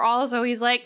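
normal talking voice

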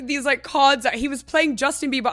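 normal talking voice

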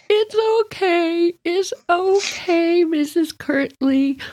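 put-on high, slow little-girl reassurance; child consoling her teacher; comforting little girl. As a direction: soothe the crying teacher like a small child.

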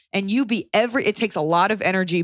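ordinary talk

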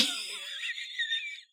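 high burst of laughter. Real, not acted. Laughs at husband's reaction.

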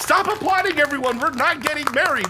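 flustered call to the room; calling over applause. Acted. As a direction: groom protesting to the wedding crowd. Stopping crowd applause.

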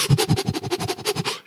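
rapid, voiceless puffing breaths; imitated shocked breathing. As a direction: mimic panting under shock. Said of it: "breathing, like".

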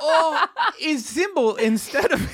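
mock German accent, laughing through it; a hammed-up German officer; comic fake German accent, high and giggly. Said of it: "I can't do a German accent".

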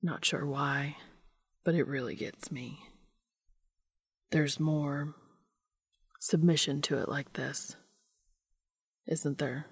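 soft, low, half-whispered murmur; mumbled. A narrator's reading. Dean close to Cass's ear, mumbling low. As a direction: keep it low and intimate, nearly whispering. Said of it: "Dean mumbles close to Cass's ear".

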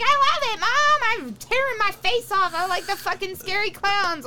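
put-on high, loud little-kid voice; mock toddler gushing; imitating her toddler. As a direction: squeal it like an excited two-year-old. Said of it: "And she's like".

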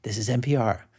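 low, soft radio sign-off; lightly voiced public radio host closing the show; understated announcer's tag line; NPR sign-off. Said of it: "they end saying".

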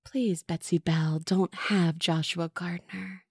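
slow, soft, low ghostly hiss; hissing spirit. A narrator's reading. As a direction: whisper a threat into her ear. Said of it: "The spirit hissed in her ear".

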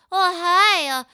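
hammy harassing boss; sleazy boss. Retold or imitated. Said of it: "as he's like".

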